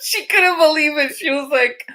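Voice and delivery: talking through real laughter; high pitched, laughing as she tells it. Real, not acted. Teacher retelling a student's embarrassing moment, laughing.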